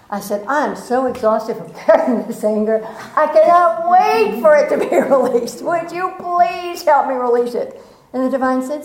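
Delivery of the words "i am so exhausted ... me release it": higher and louder, laughing through a worn-out plea; hammed-up weary relief; exhausted laughing plea. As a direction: beg for help while laughing. Finally giving up anger, laughing.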